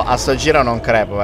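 ordinary talk, nothing marked

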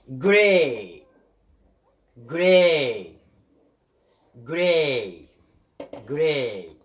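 جراي slow, with a long "ay". Shown as a demonstration. Teacher models the second case, long ay. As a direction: draw out the "ay" at the end of جراي. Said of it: "طويلة، إي".

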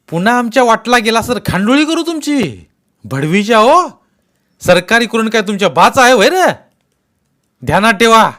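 very loud, high furious warning; shouted threat. Acted. Settlement men warning the villagers, furious shouting. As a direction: roar it as a final threat.

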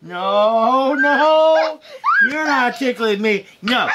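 laughing protests while tickled; being tickled. Real, not acted. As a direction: squeal and laugh through the words. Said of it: "You're trying to tickle me".

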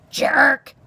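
loud, high burst of scorn; scornful. A narrator's reading. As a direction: spit out the insult loudly. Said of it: "Aerith said scornfully".

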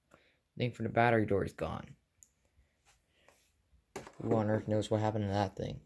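ordinary talk

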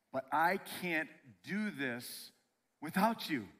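high, loud, insistent plea; pleading. Acted. Elijah telling God he can't do it alone, pleading.

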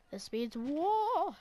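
drawn-out, rising, loud war cry; playful shouted declaration of war; mock battle cry. Mock battle cry after being shot.

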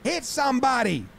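high, alarmed complaint to the umpire; mock frantic protest; acted yelling. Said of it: "He's gonna yell to the umpire".

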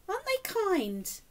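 plain reading voice

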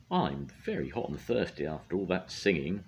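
ordinary delivery, nothing marked

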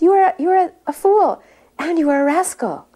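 high, loud imitation of name-calling; retold heavy scolding. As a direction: hurl the insults as the guru. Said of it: "getting heavier and heavier with him".

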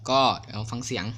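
ordinary talk, nothing marked

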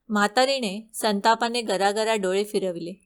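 plain reading voice

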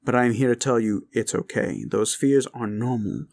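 usual speaking voice

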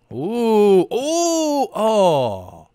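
usual speaking voice

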